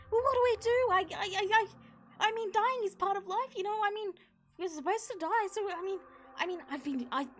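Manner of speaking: high, stammering nervous babble; flustered centaur stammering about dying; panicked babble. Acted. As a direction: ramble on in rising panic. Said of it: "he starts to panic".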